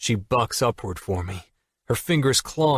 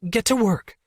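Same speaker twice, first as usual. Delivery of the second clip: much higher, flustered order; flustered Allie; 12 semitones higher than usual. Acted. Allie shooing Jack.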